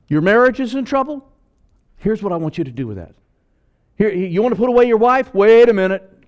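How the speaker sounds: high, loud, incredulous pulpit challenges; full-force preaching. Acted. Preacher confronts the congregation about marriage, loud and high. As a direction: drive it home to the congregation.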